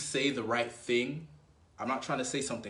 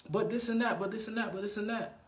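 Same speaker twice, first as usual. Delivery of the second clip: mock girl protesting; 9 semitones higher than usual. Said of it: "if she says".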